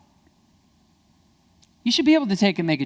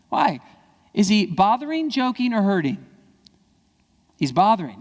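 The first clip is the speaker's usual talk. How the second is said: normal talking voice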